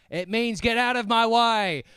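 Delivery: put-on high, loud British-accented shout; exasperated old British man's bellow; yelling old Brit. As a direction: holler back as you speed past. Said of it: "He kept yelling".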